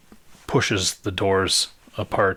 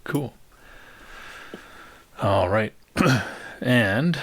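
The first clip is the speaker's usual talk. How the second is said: ordinary delivery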